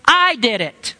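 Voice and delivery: stressed I, then a firm drop on did it; emphatic admission. Retold or imitated. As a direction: hit I hard, owning it. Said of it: "you will say emphatically".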